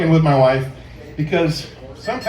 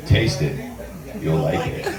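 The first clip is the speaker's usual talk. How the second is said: much lower, seductive "Taste it", laughing at the end; sultry low invitation; sexy tone; 12 semitones lower, 4 dB quieter than usual. Shown as a demonstration. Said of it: "how would you say it?".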